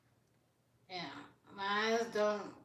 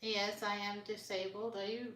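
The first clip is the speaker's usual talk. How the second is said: usual speaking voice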